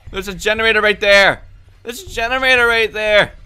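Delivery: high, loud frightened yells; panicked yelling. Real, not acted. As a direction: scream as the monster closes in. Panicked by game monster.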